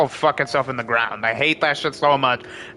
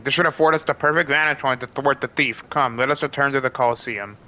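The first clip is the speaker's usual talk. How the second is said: reading dialogue aloud; 2 semitones lower than usual. Acted. Reads game dialogue aloud.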